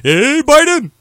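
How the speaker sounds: very high, loud cartoon holler; Barack Hussein Obama. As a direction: call out like a cartoon bear playing president. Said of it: "my Barack Hussein Obama impersonation".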